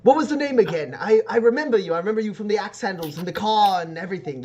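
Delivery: high-pitched, animated character voice; excitable Anton Granger figure. Acted. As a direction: play him pitched well up, eager to place a face. Game master voices Anton Granger.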